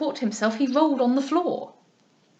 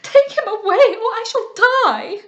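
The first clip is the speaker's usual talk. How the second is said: shrill terror; 11 semitones higher, 8 dB louder than usual. A narrator's reading. Lady Louisa wailing at the monkey, very high and loud. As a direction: wail it in theatrical panic. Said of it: "cried Lady Louisa".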